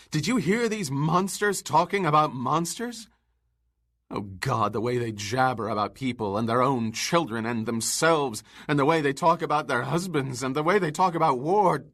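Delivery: angry tirade. Acted. Montag's angry tirade at women.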